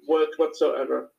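normal talking voice

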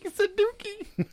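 put-on high, slow childish giggle; giggly high voice. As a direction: say it like a giggling kid. Own quote, giggly high.

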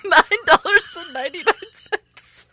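high-pitched, breaking up with laughter; laughing. Real, not acted. Host cracking up while reading donation prices, laughing through it. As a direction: squeal it through helpless laughter.

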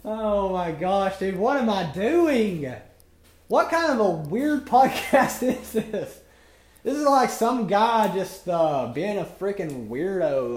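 loud, high, drawn-out exclaiming; amused dismay. Real, not acted. Host mocking his own weird podcast, loud amused dismay. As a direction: exclaim slowly in laughing disbelief.

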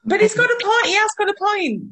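high, loud insistence; loudly sticking up for him; emphatic protest. Real, not acted. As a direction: argue back, pitched right up. Insists in mock debate.

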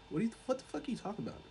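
high, soft, very fast brush-off; mock bafflement. Dracula brushing off the captive, mock bafflement. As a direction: rattle it off high and dismissive.

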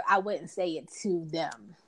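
ordinary talk

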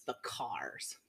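soft whispered guess; whispering. Real, not acted. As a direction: whisper it to yourself.

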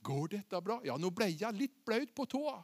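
soft, hesitant thinking aloud; acted hushed unease; quiet inner doubt. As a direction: voice Peter's worry under your breath. Acting Peter's inner doubts.

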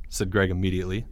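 ordinary delivery

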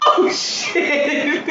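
high laughing outburst; squealing with laughter. Real, not acted. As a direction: burst out laughing at the memory. Laughs at the story.

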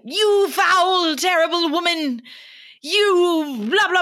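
high, loud mock berserk rant. Friend retelling Hermione's berserk rant at Rita, loud and high. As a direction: shriek it like a furious schoolgirl. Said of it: "goes berserk".

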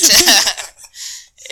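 loud high laugh after the line; cracking up at his own retelling. Real, not acted. Fan laughing at the hammock line, high laughter.

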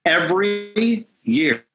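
every year stretched slow and high; drawn-out emphasis. Real, not acted. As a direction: lean on EVERY YEAR slowly. Declaring the church's yearly discipline.

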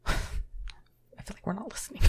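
breathy sigh, then a hushed whisper. Real, not acted. Whispering to the partner that they aren't listening. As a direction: drop to a whisper as if sharing a secret. Sudden whisper mid-conversation.

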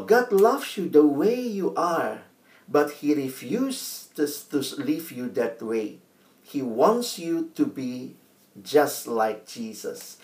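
slow, soft, earnest English quote; slow solemn quote. Acted. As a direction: let each word land softly. Quoting Max Lucado in English.

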